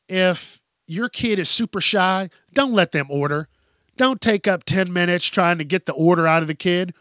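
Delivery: usual speaking voice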